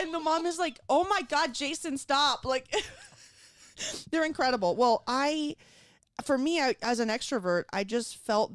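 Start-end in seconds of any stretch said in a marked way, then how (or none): 0.9-2.4 imitated scandalised mom. Acting the child's scandalised mother.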